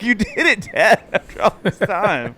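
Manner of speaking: high-pitched imitation of astonished children; amazed kids. Kids praise dad for finally making mom laugh, imitated amazement. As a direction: congratulate Dad in mock wonder. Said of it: "the kids were all so amazed".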